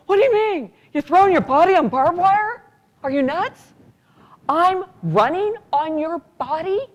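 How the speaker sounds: high, alarmed disbelief; disbelieving. Retold or imitated. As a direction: protest as if he's crazy. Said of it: "I go".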